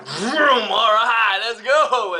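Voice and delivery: loud, high car-revving cheer; excited vroom. Acted. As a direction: rev it up and cheer. Personnage qui fonce au feu rouge. (cri excité de joie)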